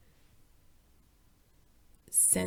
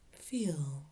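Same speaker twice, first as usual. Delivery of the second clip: meditative labeling; 2 semitones lower than usual. Shown as a demonstration. Teacher showing listeners how to label what they notice, meditative labeling. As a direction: place the label gently on the moment. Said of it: "Labeling it like this".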